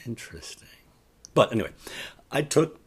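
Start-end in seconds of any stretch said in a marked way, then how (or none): 0.1-0.7 whispered. Acted. Repeat whispered.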